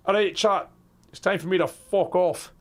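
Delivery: ordinary talk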